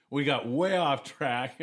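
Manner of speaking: ordinary delivery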